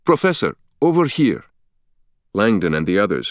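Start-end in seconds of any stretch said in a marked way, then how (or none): 0.1-1.4 put-on high, eager call in a Turkish accent; excited call. Guide hailing Professor across the courtyard, eager Turkish-accented call. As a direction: wave him over with a lilting Turkish accent. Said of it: "waving his arms excitedly".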